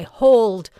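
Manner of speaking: loud, high cry to stop; cry of hold. Acted. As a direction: shout it out as a warning to halt. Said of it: "to cry".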